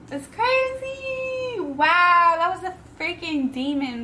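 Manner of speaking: high, drawn-out wail of disbelief; close to crying. Real, not acted. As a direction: voice climbs high, fighting real tears. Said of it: "Woo, don't cry".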